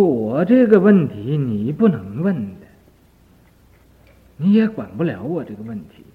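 loud and pushed away, the man who is weeping; loud refusal. Acted. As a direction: brush him off with a loud cry. Crying man refuses Shariputra's help.

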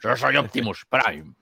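imitating a slow Castilian Spanish Optimus Prime; acento castizo de Optimus Prime. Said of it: "hablando en español castizo con acento y todo". (imitando a Optimus Prime con acento castizo)

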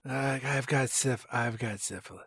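soft, muffled shamed mumble; a daughter mumbling a confession to her mom; mumbled confession. Acted. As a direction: mumble it so she can't hear.

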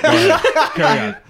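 high, slow laughing go-ahead. Real, not acted. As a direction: laugh it off sheepishly. Embarrassed laugh, waving on.

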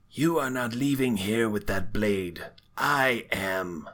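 lightly voiced menacing warning; angry villain. Villain claiming the blade from the players, mildly angry threat. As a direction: lay down a threat in character. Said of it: "She steps forward angrily".

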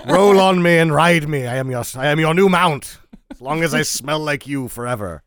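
loud, grand servant's vow; loyal knight. Retold or imitated. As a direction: pledge it like a devoted vassal. Dog voiced as loyal servant.

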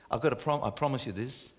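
usual speaking voice

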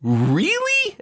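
very high astonished question; can't believe it. Real, not acted. Incredulous at friend's crush.

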